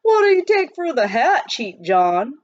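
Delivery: loud high yell across the crowd; yelled question. A narrator's reading. A miner yelling at the Cheap John, loud and high. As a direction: call out from the back of the crowd.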